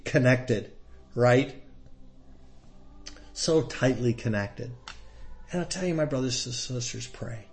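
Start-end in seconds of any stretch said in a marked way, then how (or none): none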